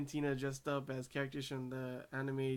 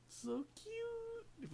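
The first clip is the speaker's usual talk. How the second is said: a much higher, softer squeal on cute; fangirl squeal; 7 dB quieter than usual. Real, not acted. Fan squealing to viewers about Tina calling him Oni-san, tiny high squeal. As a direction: squeak it out like you can't handle the cuteness. Gushing over a cute scene.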